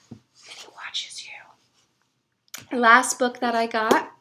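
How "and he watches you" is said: slow, whispered and very soft; spooky whisper. Acted. Joking to viewers that the warble watches them, slow spooky whisper. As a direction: whisper it like a creepy omen.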